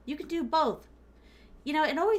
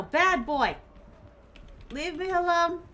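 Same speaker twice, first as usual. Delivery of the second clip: scolding; 5 semitones higher, 3 dB louder than usual. Real, not acted. Scolding the killer in-game.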